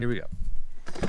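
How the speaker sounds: slow whisper. Real, not acted. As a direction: whisper it before the reveal. Sneaking in to surprise kids.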